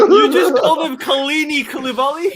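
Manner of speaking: high, loud laughing question; laughing and incredulous; laughing disbelief. Real, not acted. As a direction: crack up at the mangled name.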